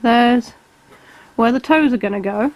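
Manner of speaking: usual speaking voice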